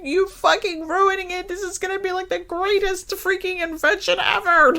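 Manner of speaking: very high, frantic scientist voice; distraught scientist. Retold or imitated. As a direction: wail it like a panicked inventor. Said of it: "he's just so, like, distraught".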